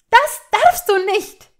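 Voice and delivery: high, loud, slow shout; furious protest. A narrator's reading. Hermine boxing Malfoy, furious protest. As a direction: forbid him with every word hammered out.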